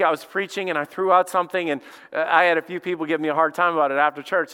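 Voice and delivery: ordinary talk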